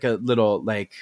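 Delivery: ordinary talk, nothing marked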